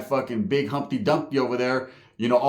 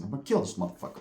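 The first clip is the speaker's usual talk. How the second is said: hushed threat; 5 semitones lower, 8 dB quieter, faster than usual. Retold or imitated. Hell's Angel repeats his plan quietly.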